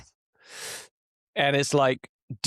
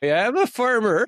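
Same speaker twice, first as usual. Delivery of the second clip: high, loud mock-proud boast; mock proud farmer; 10 semitones higher, 6 dB louder than usual. Answering the teasing wife: high, loud mock-proud farmer boast.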